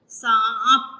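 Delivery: nasal सांप; nasal vowel. Shown as a demonstration. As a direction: push सांप through the nose. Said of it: "नाक से हम आवाज़ निकालें".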